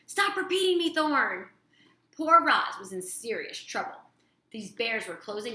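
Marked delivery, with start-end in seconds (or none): 0.1-1.5 angry. A narrator's reading. Sister scolds brother.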